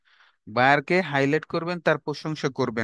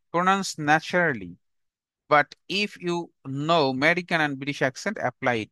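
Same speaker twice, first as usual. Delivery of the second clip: slow dictation; much slower than usual. Shown as a demonstration. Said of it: "repeated dictation".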